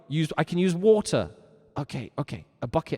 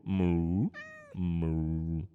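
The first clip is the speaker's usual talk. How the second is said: low, drawn-out moo; long low imitation cow; 10 semitones lower, 5 dB quieter than usual. Shown as a demonstration. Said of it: "you better be mooing".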